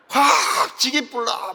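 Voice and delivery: mock Gyeongsang roar, slow and loud; Gyeongsang dialect threat. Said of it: "경상도가 제일 좀 무식한 것 같더라고요".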